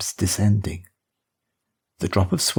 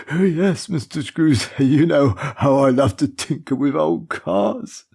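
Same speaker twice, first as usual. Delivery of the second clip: put-on much higher, beaming old servant with a little laugh; proud, chuckling old chauffeur; 5 semitones higher, 3 dB louder than usual. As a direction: share your pride in tinkering with cars.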